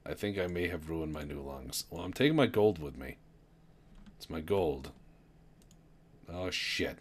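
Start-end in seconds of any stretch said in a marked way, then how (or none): none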